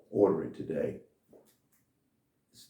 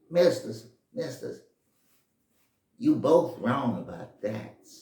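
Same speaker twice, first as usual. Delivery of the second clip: put-on high, loud boyish voice calling up; a little boy piping up to rich men; shoe shine boy voice; 5 semitones higher, 7 dB louder, slower than usual. Shoe shine boy calls up to the rich men, high and loud.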